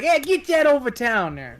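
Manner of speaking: high, drawn-out boss's order; pizza boss. Acted. Boss ordering a delivery over town, drawling bossy. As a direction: stretch it out like a pizzeria boss. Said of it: "the boss is like".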